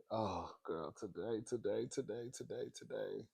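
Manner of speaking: very soft, overwhelmed repeating; hushed excitement. Real, not acted. Telling viewers about today's leaked scenes, hushed. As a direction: hush it right down, as if overcome.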